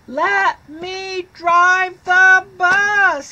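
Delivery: high, loud, slow yell; yelling pigeon. Acted. Pigeon yells at the children to drive the bus, loud tantrum. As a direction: bellow the demand like a tantrum. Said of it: "He's yelling".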